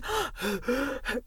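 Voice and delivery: sharp, soft, breathless gasp; gasp for breath. Shown as a demonstration. Coach demonstrates gasping between words, breathy gasp. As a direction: show a bad mid-sentence gasp for air. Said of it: "gasping for breath".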